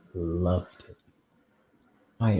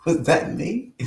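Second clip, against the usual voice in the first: laughing surprise; 9 semitones higher, slower than usual. Real, not acted. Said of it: "what happened?".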